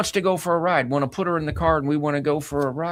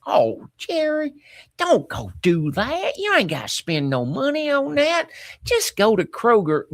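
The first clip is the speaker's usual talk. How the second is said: put-on Mississippi accent; 9 semitones higher than usual. Son voicing his dying mother telling stepdad, Mississippi accent. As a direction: imitate Mom's Mississippi accent, pitched well up. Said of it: "in her Mississippi accent".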